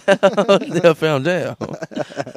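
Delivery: very slow, hammy French; French accent. Acted. Echoing the episode title, very slow French accent. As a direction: stretch it out in mock French, near laughter. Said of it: "make it all French".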